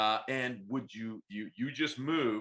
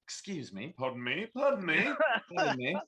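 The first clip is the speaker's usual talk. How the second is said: polite excuses; 8 semitones higher, 4 dB louder than usual. Acted. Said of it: "He's like".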